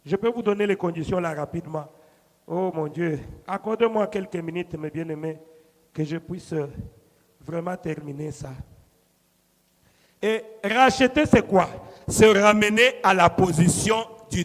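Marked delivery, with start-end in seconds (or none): 2.5-8.5 low, soft plea to God; sinking quietly into supplication; hushed prayer. Real, not acted. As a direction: drop to a subdued, prayerful aside. Murmured prayer aside for time.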